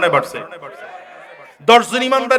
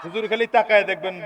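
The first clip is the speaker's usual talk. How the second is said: usual speaking voice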